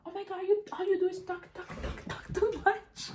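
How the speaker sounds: very high, whiny mimic of the sister; shrill-pitched mock of the sister's complaint. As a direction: squeal her nagging like a brat. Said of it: "she's like".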